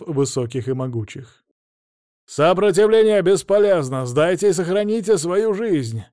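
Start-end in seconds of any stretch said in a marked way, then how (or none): 2.3-6.0 high, loud strained shout; straining shout. A narrator's reading. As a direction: yell it across the square. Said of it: "Надрывал голос командир взвода штурмовиков".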